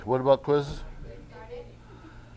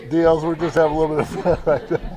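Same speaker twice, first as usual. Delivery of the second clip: fast, raised voice breaking into laughter; laughing; 5 semitones higher, 5 dB louder, faster than usual. Real, not acted. Teacher tells class they're having fun, laughing. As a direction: talk quickly and loudly through a real laugh.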